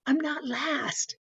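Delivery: high, slow, softened mock cheer; hushed, high-pitched glee; retold excitement. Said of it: "I got so excited".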